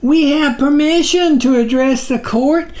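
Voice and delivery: high squeak, deferential; tiny creature's high, timid squeak; squeaky little voice. A narrator's reading. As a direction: squeak it out politely to the giant. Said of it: "His voice was not much more than a squeak".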